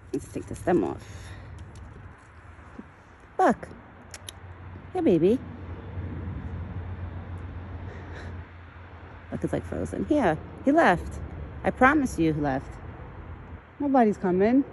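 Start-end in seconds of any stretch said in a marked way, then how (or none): 3.4-14.6 high, sweet voice calming a scared deer; soothing pet talk. Acted. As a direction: coo to the frightened deer. Comforting the deer.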